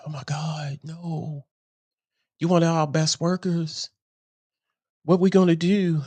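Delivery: mock-panicked boss begging a worker to stay; distressed boss. Boss pleading as speaker quits.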